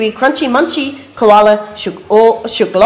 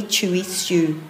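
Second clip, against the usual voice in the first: plain reading voice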